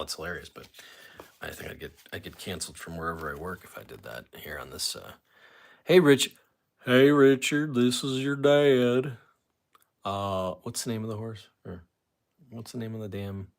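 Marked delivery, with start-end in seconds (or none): 5.8-9.2 slow, odd fatherly voice calling Richard; put-on dad voice. Caller playing Richard's dad on a message, slow put-on voice. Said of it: "I like doing the voices".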